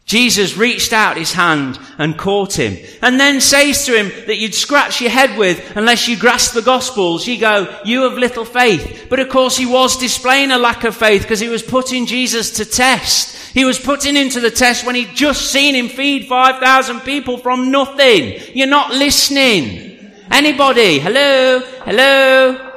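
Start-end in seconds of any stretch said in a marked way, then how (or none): 3.0-20.1 high, driving sermon delivery; forceful preaching. Real, not acted. Preacher telling the church of Peter sinking, forceful. 20.3-22.7 high, insistent call for attention. Real, not acted. Preacher to a silent congregation, calling out.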